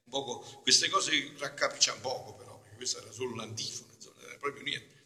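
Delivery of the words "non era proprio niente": normal talking voice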